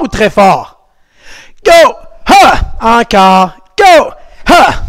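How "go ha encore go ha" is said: kiai shouts. Shown as a demonstration. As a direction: shout each kiai with full force. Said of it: "Dernière fois avec un kiai, le cri de karaté sportif".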